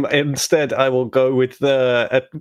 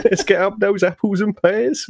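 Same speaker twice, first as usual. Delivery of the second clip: higher-pitched mock Cockney; hammed-up Cockney accent; 4 semitones higher than usual. Player hamming for laughing friends, mock Cockney accent. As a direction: lay the rhyming-slang Cockney on thick. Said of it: "Friends with Mary Poppins by any chance?".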